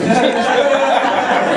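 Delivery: high-pitched laughing burst; high giggle. Real, not acted. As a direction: giggle at your own joke. Laughing at his own accent.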